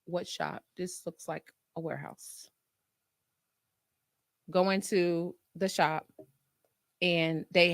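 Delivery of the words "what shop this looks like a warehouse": very soft, low skeptical aside; doubtful aside. Retold or imitated. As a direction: drop low in disbelief. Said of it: "I'm like".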